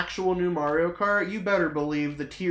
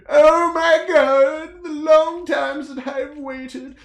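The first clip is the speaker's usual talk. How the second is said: high, loud overjoyed raving; ecstatic gush; 7 semitones higher, 5 dB louder than usual. Real, not acted. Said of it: "ecstatic over Toad's Factory".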